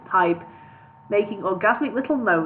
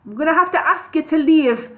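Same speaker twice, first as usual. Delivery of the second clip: louder and higher; 6 semitones higher, 5 dB louder than usual. Acted. Hobbit insists the wizard leave, louder. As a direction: raise the voice to insist. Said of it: "said Bingo in a louder voice".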